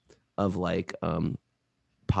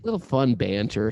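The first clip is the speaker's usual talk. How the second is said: ordinary talk, nothing marked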